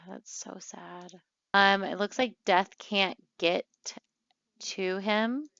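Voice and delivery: normal talking voice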